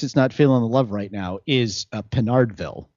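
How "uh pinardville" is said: ordinary delivery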